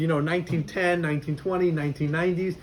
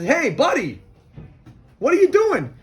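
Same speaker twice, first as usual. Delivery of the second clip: high, loud call on what are you doing; screaming across; 7 semitones higher, 4 dB louder than usual. Retold or imitated. Wise man shouts to the peddler, high loud call. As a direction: call out to him from the side. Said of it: "who screams to the guy".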